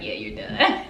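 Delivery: breathy laugh through the words; amused and reassuring, laughing; lady's answer through a laugh; laughing reply. Retold or imitated.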